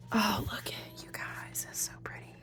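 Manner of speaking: soft awed whisper; hushed admiration. Real, not acted. Whispering to viewers about the pretty cards.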